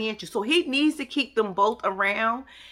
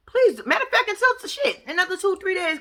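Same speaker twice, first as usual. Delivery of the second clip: suddenly pitched up, quick; high excited correction; 8 semitones higher than usual. Real, not acted. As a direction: jump up high, catching herself.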